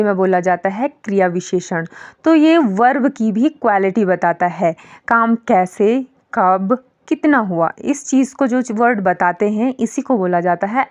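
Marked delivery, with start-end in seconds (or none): none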